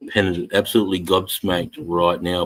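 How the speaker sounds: normal talking voice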